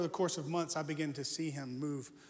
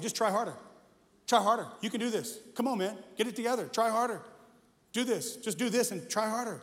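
louder, higher, hammering "Try harder" home; pushy preaching; 5 semitones higher, 5 dB louder than usual. Retold or imitated. Young pastor pushing the youth leader, loud preachy exhortation. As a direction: bark it like a coach pushing a player. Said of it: "That's what I was saying".

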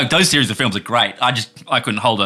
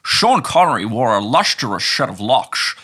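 slow Scottish accent, "s" turned to "sh"; drawn-out, slushy mock-Scottish accent; slower than usual. Shown as a demonstration. Co-host answering a dare to say it, slow Scottish accent. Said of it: "in your Scottish accent".

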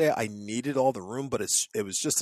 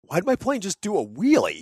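baffled; 8 semitones higher, 5 dB louder than usual. Retold or imitated. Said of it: "I'm like".

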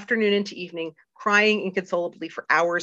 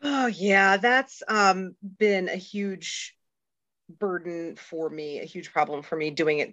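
ordinary talk

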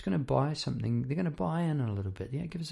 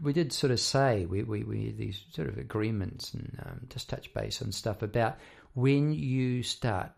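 normal talking voice

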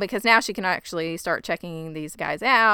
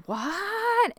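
shocked what; 13 semitones higher than usual. Retold or imitated. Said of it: "everybody was like".

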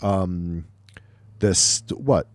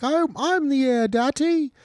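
high, whining spoiled-daughter voice; mock whiny princess complaining to her father; whiny accent; 13 semitones higher than usual. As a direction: whine it up high like a pouty heir to daddy. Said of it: "a little of my accent that I was doing".

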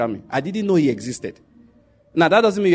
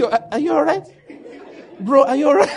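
higher, louder incredulous Are you all right, laughing; mock American disbelief; 9 semitones higher, 4 dB louder than usual. Americans mocking a Nigerian rapper, laughing incredulity. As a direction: put on an American friend staring at you, amused. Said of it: "They will look at you and say".

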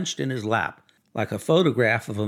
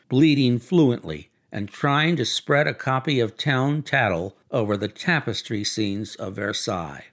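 ordinary delivery

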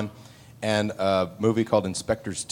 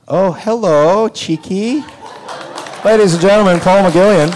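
loud high announcer voice; announcer intro; 9 semitones higher, 8 dB louder than usual. Real, not acted. Host announcing a guest to the crowd, loud and high. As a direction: boom it out like an emcee.

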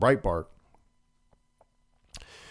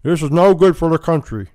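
mock McConnell; 6 semitones higher, 7 dB louder than usual. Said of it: "he noted".

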